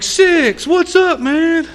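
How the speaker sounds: high, loud hail to a buddy; excited greeting. Retold or imitated. Former cellmate greeting him from the car, loud excited hail. Old acquaintance hails him, retold.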